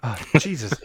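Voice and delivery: ordinary talk, nothing marked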